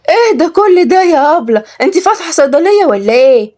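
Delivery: high, wide-eyed wonder; amazed. Acted. Rawhiya seeing all the creams, dazzled. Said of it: "وروحية قالت بانبهار".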